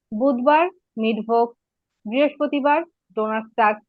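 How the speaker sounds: normal talking voice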